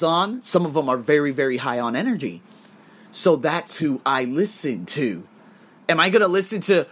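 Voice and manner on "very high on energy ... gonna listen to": ordinary talk, nothing marked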